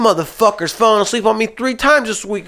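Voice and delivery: high-pitched retold outrage; indignant complaint. Driver blaming his sleeping partner to the boss, indignant.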